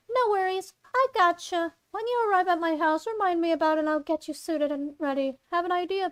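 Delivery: high, quick friendly character voice; Zoe's voice. Acted. Zoe texts Claire about her costume, high voice. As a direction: lift the pitch and rattle off the reassurance. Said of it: "Zoe comes back with a message".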